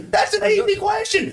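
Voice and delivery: high, drawn-out shout; angry interjection. Acted. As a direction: cut in with a slow, heated yell. Cuts in angrily during argument.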